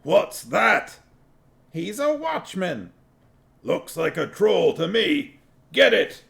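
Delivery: high dwarf voices egging each other on; high angry dwarf. A narrator's reading. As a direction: yell it like a scared dwarf rallying the mob. Dwarves mob yelling.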